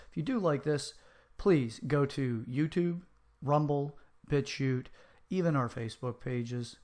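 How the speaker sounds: slow, measured podcast sign-off; show outro. Acted. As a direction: thank the listeners in a low-key, unhurried announcer read.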